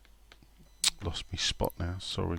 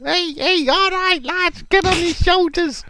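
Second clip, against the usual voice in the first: much higher greeting voice; impression of Kev. Player greeting his manager, high put-on voice. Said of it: "He'd be like".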